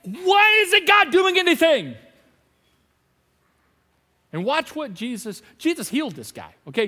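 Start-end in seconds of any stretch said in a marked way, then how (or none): 0.0-2.0 loud, high, slow complaint; raw frustration. Retold or imitated. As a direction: vent the doubt out loud. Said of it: "You catch me on a raw day".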